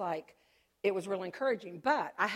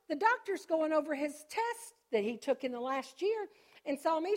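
normal talking voice

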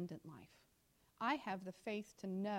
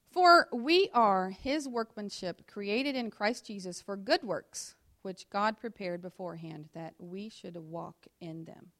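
loud, slow, deliberate scripture read; 11 dB louder, slower than usual. Acted. Preacher reading Ephesians to the congregation, slow and loud. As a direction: proclaim each phrase to the room.

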